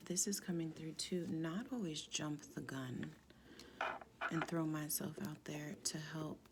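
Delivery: usual speaking voice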